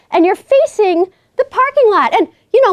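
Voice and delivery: ordinary talk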